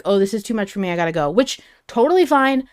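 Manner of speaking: normal talking voice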